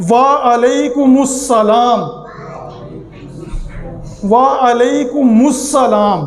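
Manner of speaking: slow "وعلیکم السلام" with a pesh on mim; careful pronunciation. Shown as a demonstration. Teacher modelling the correct reply for viewers, slowly. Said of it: "میم کے اوپر پیش ہے".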